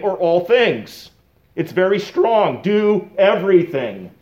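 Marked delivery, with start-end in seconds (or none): 2.6-4.1 "Do everything" said slowly, leaning hard on "everything"; stress on everything. Shown as a demonstration. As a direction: press down on "everything", slow and firm. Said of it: "That's the emphasized word here".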